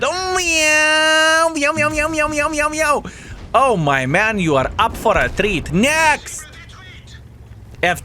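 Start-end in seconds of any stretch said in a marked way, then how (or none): 0.0-3.0 sing-song cat taunt; mocking meows. Mocking meows after kill. 5.7-6.4 cocky shout; triumphant call. Real, not acted. Calling the next victim.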